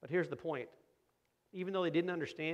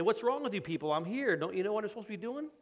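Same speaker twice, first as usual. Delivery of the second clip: mock exasperated rudeness; 7 semitones higher, 4 dB louder than usual. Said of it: "He didn't say".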